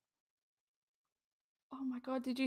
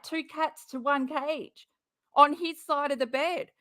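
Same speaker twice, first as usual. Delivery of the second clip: normal talking voice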